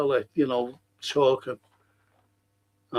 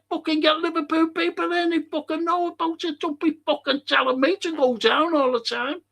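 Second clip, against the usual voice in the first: much higher heated rant; fuming rant; 13 semitones higher than usual. Retold or imitated. Refusing to go down again, fuming rant. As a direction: vent hard at them. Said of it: "I was fuming".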